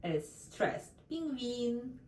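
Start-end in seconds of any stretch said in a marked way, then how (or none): 1.1-2.0 Penguin said the Russian way, with stress on the second syllable, гвин; Russian пингвин. Shown as a demonstration. Teacher repeats the Russian word for learners, stress on the second E. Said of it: "the second E is stressed".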